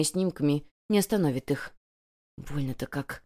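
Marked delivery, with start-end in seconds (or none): 2.4-3.2 soft, low murmur of pain; pained mutter. A narrator's reading. Wounded boy to himself, muttering in pain. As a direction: murmur it under your breath, hurting. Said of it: "морщись пробормотал Вересов".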